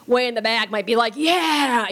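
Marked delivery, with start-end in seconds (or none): 1.2-1.9 put-on drawn-out drunken cheer; drunk guy's guffaw. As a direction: holler it like a drunk from the back row. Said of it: "a guffaw from the drunk guy".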